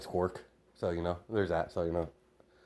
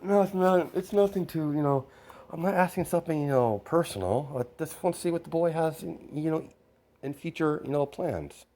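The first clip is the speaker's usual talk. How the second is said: father brushing it off in a higher voice; protesting father; 8 semitones higher than usual. Acted. Father reassures his daughter he only wants the boy's plans, protesting. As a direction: pitch up high, play the father defending his question.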